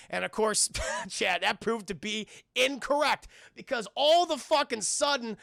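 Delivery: normal talking voice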